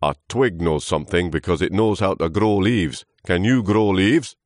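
loud, scornful character voice; lecturing old trunk. Acted. Old trunk testing Lewis, loud lecturing. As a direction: quiz him loudly and pompously.

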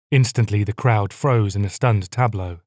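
ordinary delivery

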